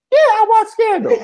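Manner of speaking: high, loud mock answer; mimicking friend. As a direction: squeal it back like an eager friend. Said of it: "Janiyah was like".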